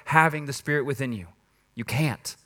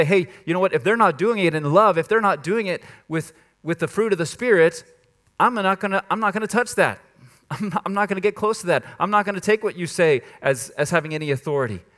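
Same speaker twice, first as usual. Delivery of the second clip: raised, louder acted rebuff; firm refusal; 6 semitones higher, 6 dB louder than usual. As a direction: push back emphatically, as if turning someone down. Acted refusal to a false teacher.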